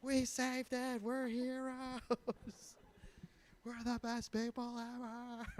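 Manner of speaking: high, soft, slow proud hero; mock proud hero. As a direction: boast softly and slowly like a smug hero.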